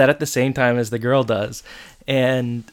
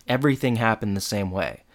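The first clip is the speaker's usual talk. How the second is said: usual speaking voice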